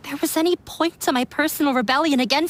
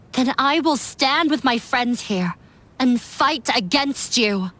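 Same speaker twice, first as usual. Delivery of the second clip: ordinary delivery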